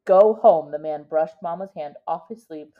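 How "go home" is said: curt dismissal. A narrator's reading. Official dismisses mother.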